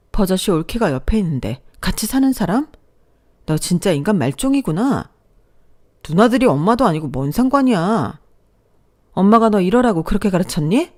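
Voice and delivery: angry shouting. Acted. Sister shouts at her shameless brother, angry. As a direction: tear into him with outrage.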